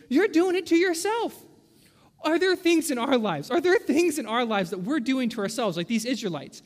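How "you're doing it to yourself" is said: God's retort. Acted. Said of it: "God's looking at us and goes".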